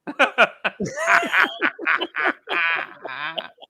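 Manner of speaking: a long high giggle that rises; high squealing laugh. Real, not acted. Giggling hard at the shallots joke.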